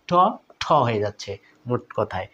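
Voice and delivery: normal talking voice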